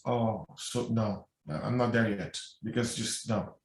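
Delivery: hushed, hesitant woman refusing to forgive; hushed refusal. Retold or imitated. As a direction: shrink away from the advice with a quiet no. Woman stalls at the advice.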